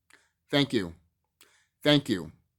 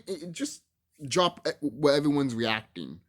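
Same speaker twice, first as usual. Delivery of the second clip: normal talking voice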